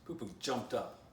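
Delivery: plain reading voice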